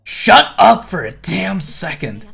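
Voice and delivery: angry snap. Acted. Ryoshu cutting the swordsman off, loud and angry. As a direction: bite his head off.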